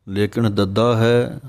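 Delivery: ordinary talk, nothing marked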